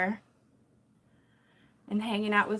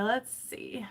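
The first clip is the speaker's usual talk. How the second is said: whispered; 5 dB quieter than usual. Real, not acted. Muttering while fixing the chat.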